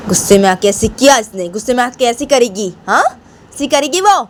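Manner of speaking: high-pitched scolding granny voice; scolding grandmother. Acted. Grandmother scolding the daughter-in-law for hurting Bani, high outraged voice. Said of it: "तो बानी की दादी बानी को बोलती".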